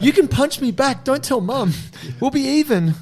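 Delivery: mock pleading. Pleading with injured friend, joking.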